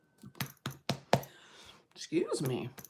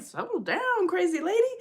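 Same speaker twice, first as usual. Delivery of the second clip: put-on lightly higher, dismissive young guy; mock worker; 11 semitones higher than usual. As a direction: toss it off like a bored teen. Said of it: "It's like".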